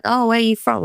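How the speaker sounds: put-on high-pitched go at a half-English, half-American accent; attempted English-American accent. Imitating a young woman greeting a stranger at a conference, attempted English-American accent. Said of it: "I can't do her accent".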